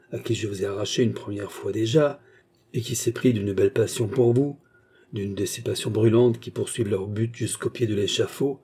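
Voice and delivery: usual speaking voice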